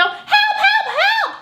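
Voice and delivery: very high, squeaky mock cries for help, laughing; mock panicked cries. People crying help in song.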